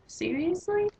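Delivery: high, rising disbelief; incredulous. Real, not acted. Student reacting to being hushed like first graders, incredulous. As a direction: question it in high-pitched disbelief.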